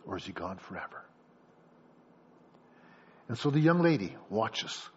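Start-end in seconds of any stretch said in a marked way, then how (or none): 0.1-1.0 low, hushed question to God; prayerful plea. Acted. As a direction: ask it softly, like a mother praying. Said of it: "And you ask the Lord".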